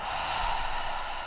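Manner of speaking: long, soft, audible breath out emptying the lungs; demonstration exhale. Shown as a demonstration. Teacher breathing out with her students, long audible exhale. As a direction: let the whole breath go slowly. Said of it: "And breathe out".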